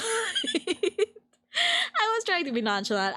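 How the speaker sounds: giggling it out slowly in a high voice; laughing, slow and high. Shown as a demonstration. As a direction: laugh through it, stretched out and high. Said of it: "laughs, repeated slow and high".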